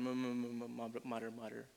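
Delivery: muttering that fades away to nothing; fading mutter. Shown as a demonstration. The crowd's grumbling imitated, fading to a mutter. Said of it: "The grumbling goes on".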